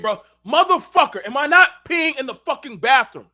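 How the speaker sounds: high, loud outraged comeback; loud high retort again; exasperated yell. Retold or imitated. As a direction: hammer the word not in disbelief. Repeated angry retort.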